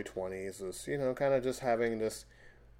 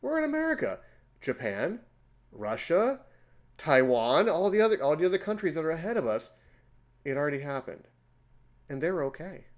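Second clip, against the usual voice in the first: much higher, louder, fast retold rant; excited explanation; 5 semitones higher, 5 dB louder than usual. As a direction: rattle off the countries incredulously. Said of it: "I'm like".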